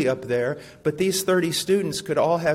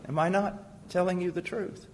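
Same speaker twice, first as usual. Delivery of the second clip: ordinary talk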